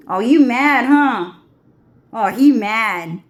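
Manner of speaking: a loud, gloating taunt. Real, not acted. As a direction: needle them, loud and smug. Taunting the angry crowd.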